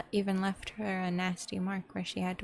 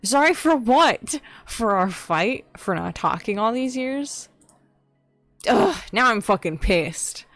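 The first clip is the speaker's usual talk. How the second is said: outraged shout; 3 semitones higher, 9 dB louder than usual. Acted. Outraged at the sister's hollow apology, shouting. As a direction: demand an answer, furious.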